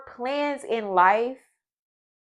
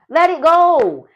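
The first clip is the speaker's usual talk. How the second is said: belted out, much louder and higher; shouted command; 5 semitones higher, 8 dB louder, slower than usual. Real, not acted. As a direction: shout it so they finally let go. Building a repeated command.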